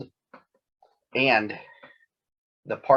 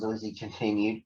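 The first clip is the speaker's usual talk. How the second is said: ordinary delivery, nothing marked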